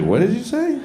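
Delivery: high, startled question; startled woman. Retold or imitated. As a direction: squeak it, caught off guard. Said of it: "She's like".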